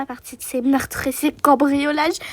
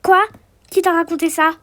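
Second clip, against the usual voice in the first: ordinary talk, nothing marked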